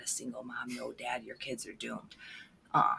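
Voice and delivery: ordinary talk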